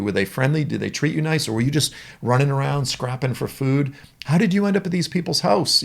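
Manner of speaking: high, eager questions to a lost dog; questioning the dog. Acted. Owner voicing questions to lost dog.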